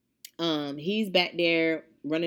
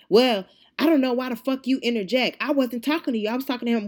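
high, indignant protest; heated objection, retold; retold argument; 6 semitones higher, 5 dB louder, faster than usual. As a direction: mimic the affronted customer, voice raised. Acted quarrel, customer objects.

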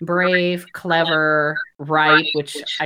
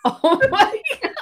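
high, laughing exclamation; real amused shock; laughing oh my god. Real, not acted. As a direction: squeal through laughter. Listener laughing at story.

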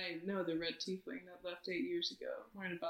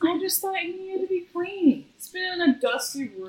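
higher, pleading excuse; snooping newcomer justifies taking the blanket; defensive whine; 10 semitones higher, 5 dB louder than usual. Acted. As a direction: plead innocence. Player defends in character.

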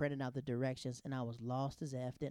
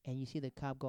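ordinary talk

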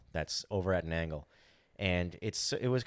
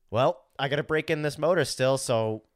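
loud, raised, resigned; louder; 4 semitones higher, 5 dB louder than usual. Retold or imitated. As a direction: shrug it off loudly. Resigned decision, retold.

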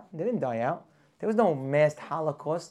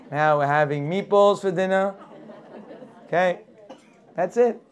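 the newcomer talks himself into assimilating, growing louder; immigrant deciding to fit in; 5 dB louder than usual. Acted. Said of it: "the guy says".

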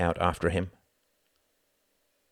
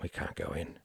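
quiet explanation; 3 semitones lower, 9 dB quieter than usual. Acted.

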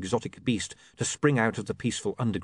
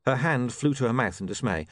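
ordinary delivery

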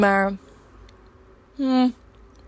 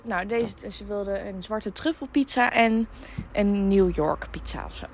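ordinary delivery

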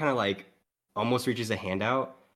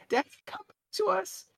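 much higher, mild mock child's cry; screaming child. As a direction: call daddy back, desperate. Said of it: "she's screaming".

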